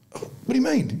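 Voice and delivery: high-pitched disbelieving question; retold bewildered protest. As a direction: protest as if stunned by the accusation. Retells his own stunned protest to coach.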